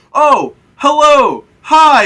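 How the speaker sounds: very high, loud, slow cheery hello; cheery oblivious greeting. Retold or imitated. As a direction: greet the monster like a friendly neighbor, oblivious. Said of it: "yelling at the creature".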